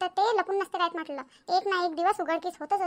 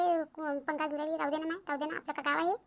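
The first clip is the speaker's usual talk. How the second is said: ordinary talk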